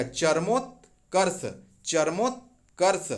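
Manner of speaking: चरमोत्कर्ष drawn out slowly, every consonant clear; slow teacher's चरमोत्कर्ष; slow careful pronunciation. Shown as a demonstration. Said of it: "कैसे लिखा आएगा".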